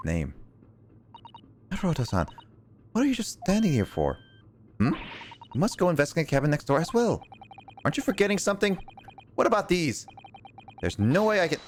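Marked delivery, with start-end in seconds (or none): 1.7-11.6 lightly voiced polite young woman, higher; bright higher girl voice, a bit quick. Game heroine voiced by player.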